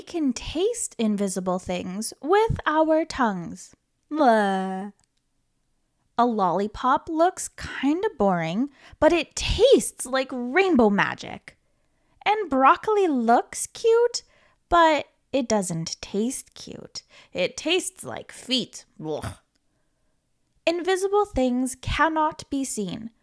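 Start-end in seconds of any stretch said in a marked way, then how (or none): 4.1-4.9 disgust. Acted. Tasting something bad. 19.0-19.4 low disgusted ugh; disgusted groan. Acted. Broccoli tastes like feet.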